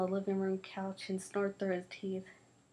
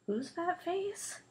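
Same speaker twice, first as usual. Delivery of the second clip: high, slow, offended "whose fat face"; indignant question; 10 semitones higher, slower than usual. Acted. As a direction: stretch it out, high and affronted. Kids trading insults.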